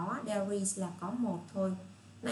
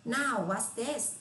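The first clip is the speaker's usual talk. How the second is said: slow model question; 3 semitones higher, much slower than usual. Shown as a demonstration. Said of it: "các bạn phải nói".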